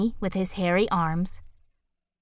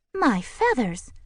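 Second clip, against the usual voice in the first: higher, slow warbling bird; warbled; 6 semitones higher, slower than usual. A narrator's reading. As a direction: warble it in dismay. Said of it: "warbled Kalinka".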